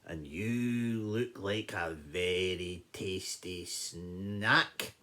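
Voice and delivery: slow, low lion savouring the words; hungry lion. Acted. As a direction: draw it out as a predator eyeing prey. Lion eyes the mouse.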